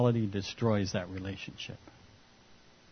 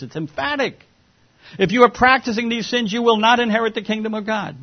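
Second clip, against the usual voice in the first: loud, much higher, emphatic list of sins; preaching at full force; 4 semitones higher, 5 dB louder than usual. Acted. Preacher warns the church from Corinthians, loud and emphatic. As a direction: thunder each name in the list.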